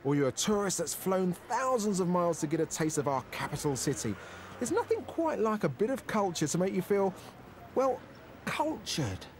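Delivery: crisp, projected magazine-show narration; TV voice-over. Acted. Presenter introduces a London restaurant to viewers, TV voice-over. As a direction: read it as a polished feature intro.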